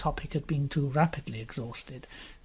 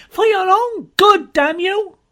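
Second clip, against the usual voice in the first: much louder, higher, slow screamed; furious scream; 12 dB louder than usual. Acted. Jean screaming at Donald, much louder and slower. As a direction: scream each word at him.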